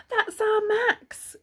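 high, drawn-out cry of recognition; gasp, then cry it out high and loud; cried out. A narrator's reading. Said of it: "cried Ma".

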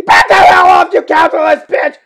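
loud, high-pitched snarling yell; furious shout. Acted. Homeowner driving off a visitor, furious shout. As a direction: explode at the visitor. Acts the movie's angry retort.

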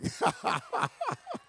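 high, soft chuckling; laugh. Real, not acted. Preacher amused after his own point, laughing. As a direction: let out a light, quick run of laughter.

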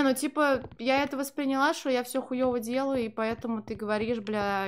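ordinary talk, nothing marked

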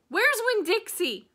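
high, loud panicked shout. A narrator's reading. Opal shouting for her lost dog, high alarmed shout. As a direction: cry out for the dog. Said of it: "I shouted".

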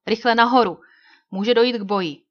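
loud urgent call down. A narrator's reading. Old Shatterhand calling captives up the ladder, urgent. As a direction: hurry them up the ladder.

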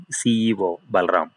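ordinary delivery, nothing marked

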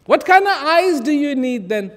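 usual speaking voice

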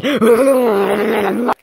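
loud, high wavering cry; mock wail. Cutting off the check-in, wavering mock wail. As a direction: wail high with a fast wobble.

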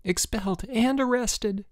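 high-pitched wail of dread; moaned dismay. A narrator's reading. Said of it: "moaned Boots".

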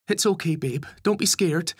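comforting mother. A narrator's reading. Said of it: "doing her best to comfort her".

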